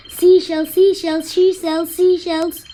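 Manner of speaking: loud, high, rapid repetition; fast tongue twister. Shown as a demonstration. Racing through the tongue-twister game, fast and loud. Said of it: "You need to say it really fast".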